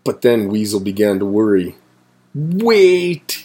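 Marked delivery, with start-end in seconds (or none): 2.3-3.4 a high, drawn-out worried wail. Acted. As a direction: cry it out in sudden worry. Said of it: "he wailed".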